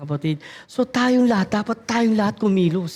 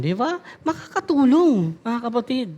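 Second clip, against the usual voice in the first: usual speaking voice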